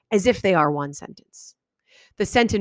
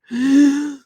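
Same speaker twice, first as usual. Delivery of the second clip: breathless gasp; 6 semitones higher, 6 dB louder than usual. Shown as a demonstration.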